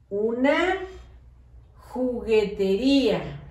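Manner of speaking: Una, a pause, then juguetería slowly; dictation with a pause. Shown as a demonstration. Teacher dictating a long word to the class, slowly with a pause. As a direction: leave a gap after Una, draw out juguetería. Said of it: "cuando yo hago pausa".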